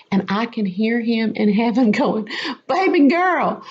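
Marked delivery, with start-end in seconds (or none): 2.7-3.6 high, lilting pet-name call; lightly voiced doting father; fond heavenly Father. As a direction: coo it like an amused parent. God voiced speaking fondly from heaven.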